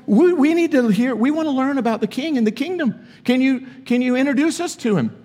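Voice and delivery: preacher mimicking Pharisees asking Jesus for the king, high and mocking; mock clueless Pharisees. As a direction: lampoon the Pharisees begging Jesus.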